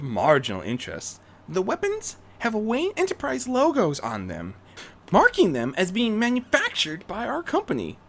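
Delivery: Rarity briefing. Acted. Rarity briefing Bruce on the weapons, high and urgent. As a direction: lay out the facts urgently.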